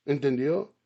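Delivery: usual speaking voice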